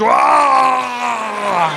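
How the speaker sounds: high mock shout of pleasure; a comic yelp of a woman's climax; mock orgasm cry. Husband imitating his wife's climax, hammed-up yelp. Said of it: "That's not exactly what she sounded like".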